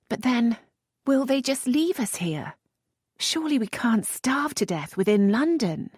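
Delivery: a hint of fear; lightly voiced worried lady. A narrator's reading. Lisette asking the earl, mildly anxious.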